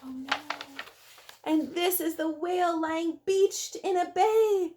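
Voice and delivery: ordinary delivery, nothing marked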